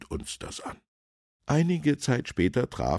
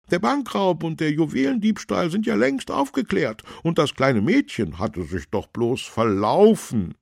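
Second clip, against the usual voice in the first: high, loud, protesting councillor; councillor character; 13 semitones higher, 8 dB louder than usual. A narrator's reading. Councillor objects to policeman's suspicion, high protesting voice. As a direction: argue back in a raised, high voice.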